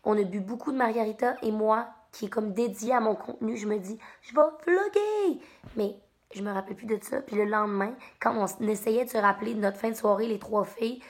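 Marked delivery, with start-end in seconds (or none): 4.2-5.4 excited. Retold or imitated. Drunk at 4am deciding to vlog.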